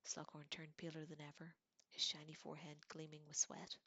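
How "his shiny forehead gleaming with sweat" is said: plain reading voice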